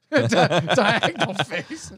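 slow, laughing through the words. Real, not acted. Host laughing at his own nickname, slow and giggly. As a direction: savor the silly name through a laugh.

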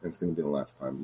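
low, rough raspy delivery. Acted. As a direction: rasp it out dark. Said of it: "Just do a raspy voice".

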